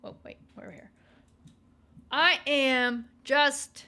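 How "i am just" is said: very slow, louder declaration; slow, louder mock proclamation; slow emphatic. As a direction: plant each word like a proud motto. Repeating meme with emphasis.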